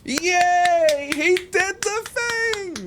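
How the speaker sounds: squeaky high, drawn-out cheer; mock cheering. Gamer cheering along with the game, mock squeaky hooray. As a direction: celebrate in a silly squeal.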